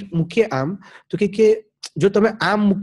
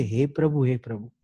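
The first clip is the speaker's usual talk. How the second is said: soft prayer call; 5 semitones lower, 6 dB quieter than usual. Shown as a demonstration. Quoting a prayer to God.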